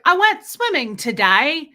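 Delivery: put-on high, lilting little British boy; British kid accent. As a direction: mimic a cute British child's accent. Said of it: "I love kids with accents".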